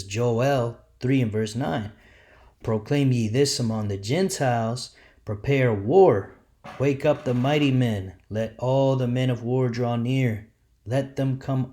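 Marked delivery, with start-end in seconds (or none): 2.6-11.7 preaching scripture. Acted. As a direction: proclaim it like a preacher. Reads Joel aloud, preaching.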